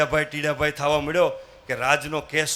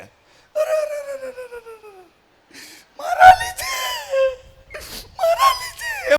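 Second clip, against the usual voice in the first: high, wailing sobs with a cry on મારા; the maid bursts into tears, blaming herself; sobbing maid; 4 dB louder than usual. Acted. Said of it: "આહુડા ટપકવા માંડ્યા". (રડતાં રડતાં, ઊંચા સાદે વિલાપ)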